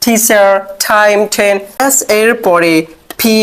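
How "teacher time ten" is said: টিচার, টাইম, টেন slow with a strong aspirated th; টিচার টাইম টেন said slowly with a breathy th; aspirated t demo. Shown as a demonstration. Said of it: "ঠয়ের মতো করবো।".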